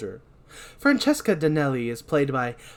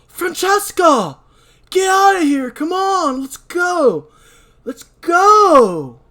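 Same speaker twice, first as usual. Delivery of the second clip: high, loud urgent yell; 11 dB louder, slower than usual. Acted. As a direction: shout her out of danger. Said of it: "Dan yells".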